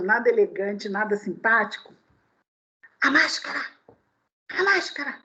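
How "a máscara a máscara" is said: gruff demanding mock man; rude man barking. Preacher imitating a rude man on the metro demanding the mask. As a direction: snap out the demand. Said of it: "com um jeito nada jeitoso".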